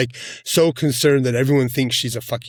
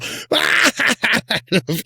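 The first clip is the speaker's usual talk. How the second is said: burst of laughter; 10 semitones higher than usual. Real, not acted. Reacting to a cringe scene.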